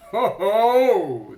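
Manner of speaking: loud, high mocking shark cry; triumphant hoot; gloating call. A narrator's reading. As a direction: taunt the dangling boy. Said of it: "the king of sharks called".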